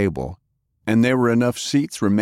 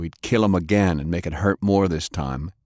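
ordinary delivery, nothing marked

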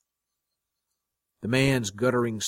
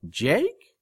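high, rising disbelief; startled question; 8 semitones higher, 3 dB louder than usual. A narrator's reading. Woman turning to see him, startled question. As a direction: ask it high, in surprise.

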